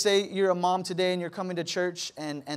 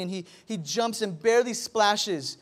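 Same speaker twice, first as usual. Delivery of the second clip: ordinary delivery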